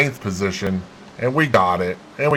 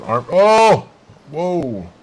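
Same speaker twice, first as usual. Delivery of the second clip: sudden high startled cry; startled gasp; 8 semitones higher, 4 dB louder, much slower than usual. Real, not acted. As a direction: caught off guard by a crash. Crash on track, sudden.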